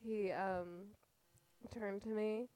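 ordinary talk

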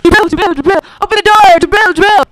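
loud shouts through a locked door; frantic calls at the door; calling out loud. Acted. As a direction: bang and call to be let in.

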